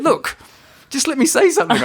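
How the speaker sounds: high, laughing insistence; mock insisting. Teller replays himself insisting in the car, high and laughing. His own quote, insisting.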